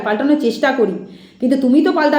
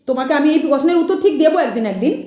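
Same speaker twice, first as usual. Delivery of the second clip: ordinary delivery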